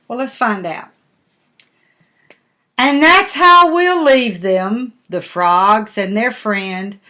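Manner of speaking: ordinary delivery, nothing marked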